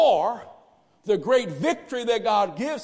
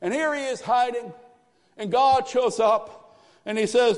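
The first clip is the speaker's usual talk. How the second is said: ordinary talk, nothing marked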